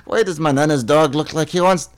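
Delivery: lilting Scottish question; Scottish accent. Acted. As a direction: puzzle over it in a Scottish accent. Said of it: "You're so from Scotland".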